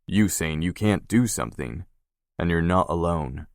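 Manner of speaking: ordinary delivery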